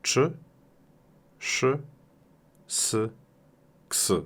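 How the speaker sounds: "Czy, szy, s, ks" as separate sounds with long gaps; isolated endings. Shown as a demonstration. Teacher listing word endings, each sounded in isolation. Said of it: "Oto te końcówki".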